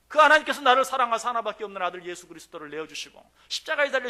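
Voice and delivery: loud, high preaching; impassioned preaching. Acted. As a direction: press the question home loudly. Preaching at full force.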